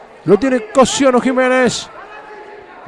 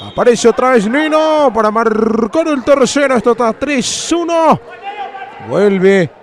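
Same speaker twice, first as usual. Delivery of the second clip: normal talking voice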